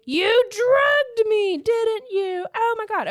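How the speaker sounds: slow, very high, loud outraged wail; hammed-up furious heroine shriek; pissed-off accusation. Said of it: "she is pissed".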